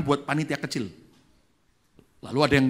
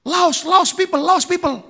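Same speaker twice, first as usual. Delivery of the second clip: halting English; 10 dB louder than usual. Retold or imitated. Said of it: "Inggrisnya juga terbata-bata".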